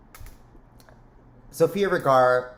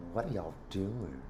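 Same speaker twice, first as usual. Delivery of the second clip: Leslie Jordan impression; 3 semitones lower, 11 dB quieter than usual. Greeting her viewers again in Leslie Jordan's voice, much softer. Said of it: "In the words of Leslie Jordan".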